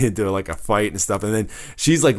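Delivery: normal talking voice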